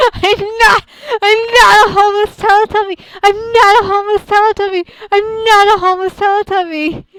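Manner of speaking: real indignant yell at the chat; outraged shout. Real, not acted. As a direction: shout it down, indignant and shrill with laughter behind. Chat calls her a homeless Teletubby.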